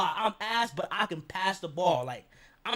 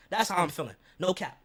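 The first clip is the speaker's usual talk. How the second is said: normal talking voice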